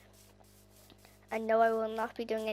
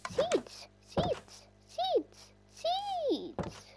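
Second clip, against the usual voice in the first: very high sing-song call; 3 dB quieter, much slower than usual. Real, not acted. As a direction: squeal it out high like spotting treasure. Calling out at found seeds.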